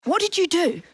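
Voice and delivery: high, alarmed question; shocked demand. Acted. Evie demands to know what he did, shocked.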